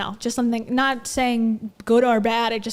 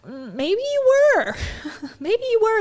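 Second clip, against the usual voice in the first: high, sly teasing; 10 semitones higher than usual. Acted. Hosts mocking a storyteller's excuse, sly high teasing. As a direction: tease her, knowing she was.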